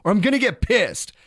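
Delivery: loud, high, angry warning; threatening yell. Retold or imitated. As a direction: shout the threat at unruly kids. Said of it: "I, like, threatened them".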